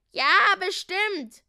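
high, loud, drawn-out cheer; cheering chorus call. A narrator's reading. Gnomes reassuring the prince in chorus, high loud call. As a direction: call it slowly like a whole chorus. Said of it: "riefen die Heinzelmänner im Chor".

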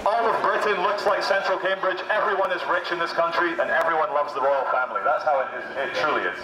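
ordinary talk, nothing marked

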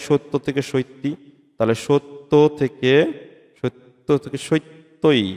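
সত্য থেকে সত্যি drawn out slowly, each word stretched; slow demonstration. Shown as a demonstration. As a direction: stretch the words so the final ই is heard. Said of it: "লাস্টে একটা ই আসতেছে". (ধীরে ধীরে টেনে উচ্চারণ)

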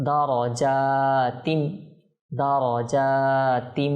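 দারজাতিন slowly, long "jaa", hummed ending; nasal ghunna. Shown as a demonstration. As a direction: draw out দারজাতিন into a held nasal m. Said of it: "ইদগাম গুন্না সহকারে".

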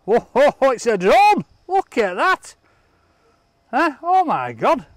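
high, loud thrilled exclamation; excited discovery. Real, not acted. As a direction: gasp with joy at the drone. Discovering the drone.

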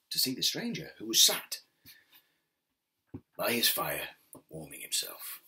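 plain reading voice

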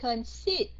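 cut-short Consider, leaning hard on SI; stress on second syllable. Shown as a demonstration. Teacher demonstrating the stressed second syllable of Concede, punched loudly. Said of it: "nhấn âm hai phải không".

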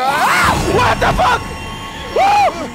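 high, loud startled yelp; shocked by the sudden attack; startled shout. Real, not acted. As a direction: jump in shock. Officer attacks.

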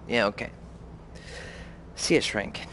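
ordinary delivery

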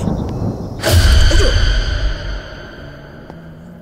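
jump-scare yell, real fright; startled scream. Real, not acted. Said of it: "del grito de antes". (grito de susto)

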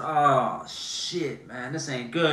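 soft, sinking "oh shit"; quiet dismay. Retold or imitated. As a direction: mutter it as you realize. Dismay on being caught.

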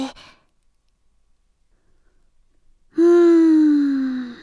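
very long, drawn-out "hmm"; troubled hum. Acted. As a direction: hum at length, weighing the worry. 不安になって考え込む. (長く伸ばす悩ましげな「うーん」)

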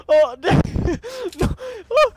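talking through being overcome; breaking up mid-sentence; overwhelmed crying. Real, not acted. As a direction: struggle to get words out while crying. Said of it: "Oh, I'm crying, dude".

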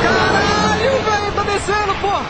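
high, alarmed burst of surprise; startled exclamation. Real, not acted. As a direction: yelp it out as it drops. Drone descendo, susto. (susto agudo)

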